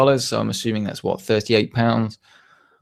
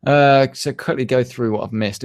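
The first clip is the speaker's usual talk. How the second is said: normal talking voice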